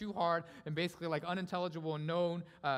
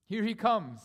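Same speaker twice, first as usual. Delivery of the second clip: loud, high proclamation; triumphant cry; 5 semitones higher, 9 dB louder, slower than usual. Acted. Preacher proclaims Christ's arrival to the church, triumphant. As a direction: ring it out to the whole church. Preacher repeats the announcement loudly.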